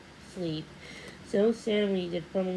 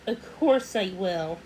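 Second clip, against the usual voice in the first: ordinary delivery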